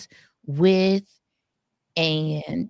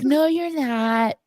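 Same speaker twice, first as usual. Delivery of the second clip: imitating Winters; 7 semitones higher, slower than usual. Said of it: "She'd be like".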